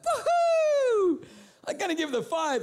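very high, loud whoop of relief; mock cheer. Preacher's cheap self cheering at God's answer, loud whoop. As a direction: whoop and celebrate.

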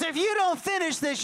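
high, urgent retold pep talk; pumped up. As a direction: imitate a fired-up doctor warning a patient. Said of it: "like, so pumped up about it".

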